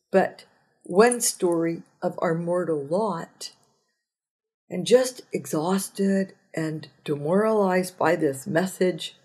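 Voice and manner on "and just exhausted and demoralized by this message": ordinary talk, nothing marked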